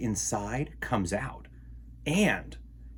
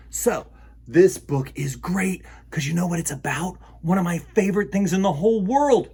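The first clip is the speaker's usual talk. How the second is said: higher, louder, eager build-up; excited; 6 semitones higher, 5 dB louder than usual. Acted. Host teasing kids about the book, excited and higher. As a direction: tease it like a big secret.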